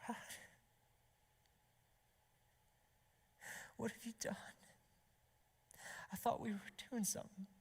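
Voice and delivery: soft, high, anguished whimper to God; acted heartbreak, hushed, with long pauses between thoughts; tearful prayer. Betrayed husband crying out to God, in tearful hushed anguish. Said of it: "his prayer journal just drips with tears".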